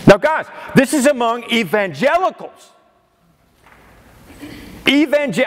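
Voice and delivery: can't believe it, voice climbing; exasperated. Real, not acted. Preacher exasperated by survey.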